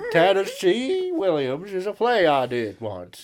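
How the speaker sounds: loud, high, hammed-up Tennessee drawl; theatrical mock Southern American, stretched out; American accent. Shown as a demonstration. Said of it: "I can do an accent".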